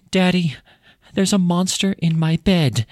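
put-on high, slow, frightened child voice; scared little girl. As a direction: whimper it like a terrified little girl. Frightened daughter voiced in story.

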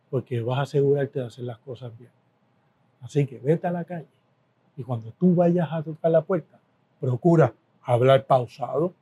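low, measured counsel with long pauses; calm mentor's advice. Retold or imitated. Boss advising a scared new collector, calm and measured. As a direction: advise gently and deliberately, letting each point settle.

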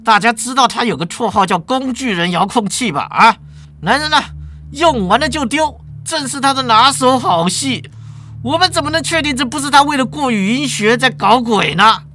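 law department chair. Acted. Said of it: "法律系主任把他压下来，站起来说".

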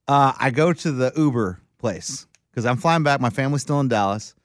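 ordinary talk, nothing marked

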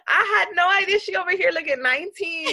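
high, loud gushing astonishment; amazed disbelief. Real, not acted. Friend learns her co-host is thirty-five, real high amazed disbelief. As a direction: laugh into it, then exclaim high and loud.

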